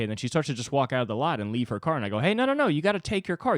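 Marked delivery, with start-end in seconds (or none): 2.2-3.6 much higher, urgent call after her. Retold or imitated. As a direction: shout her back to the car, pitched way up. Calling after woman leaving car.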